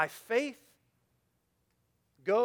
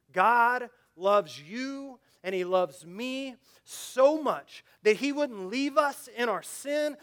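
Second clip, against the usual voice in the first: louder, higher, drawn-out preaching cadence; full-force preaching; 4 semitones higher, 6 dB louder, slower than usual. Real, not acted. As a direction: preach it out loud, stretching each phrase.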